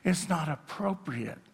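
a hushed scolding of the crowd; hushing. Retold or imitated. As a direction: shush them like a disapproving crowd. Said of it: "a crowd that's gonna say".

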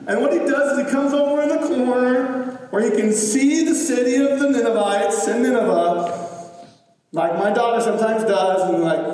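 ordinary talk

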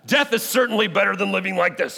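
high, dramatic outcry of despair; exclaimed. Acted. Preacher reading Jonah's complaint in the heat, exclaimed. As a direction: cry it out as an indignant prophet. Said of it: "he exclaimed".

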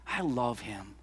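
tender. Real, not acted. Tender confession.

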